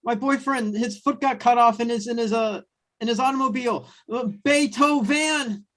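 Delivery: high panicked millennial voice; freaking out. Acted. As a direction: gush in a high panicked rush. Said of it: "the one's freaking out".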